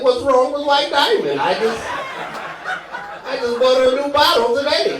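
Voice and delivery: high, loud indignant protest; hammed-up wounded indignation; mock offended. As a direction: imitate an affronted woman defending her perfume. Offended women in audience, imitated.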